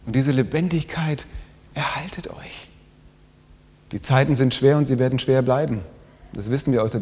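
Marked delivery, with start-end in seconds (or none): 1.7-2.7 much softer whispered ending; hushed plea. Acted. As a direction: let it fade into a whisper. Whispered closing exhortation.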